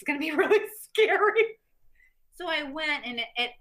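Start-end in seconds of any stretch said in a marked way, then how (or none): 0.0-1.6 nervous laugh. Retold or imitated. Retells nervous reaction to acting.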